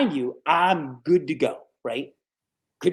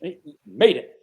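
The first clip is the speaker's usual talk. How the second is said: triumphant; 7 dB louder than usual. Retold or imitated. Celebrating landing the job.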